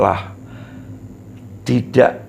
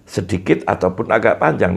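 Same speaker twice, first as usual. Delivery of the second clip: usual speaking voice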